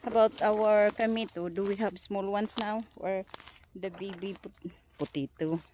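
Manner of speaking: ordinary delivery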